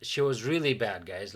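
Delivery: usual speaking voice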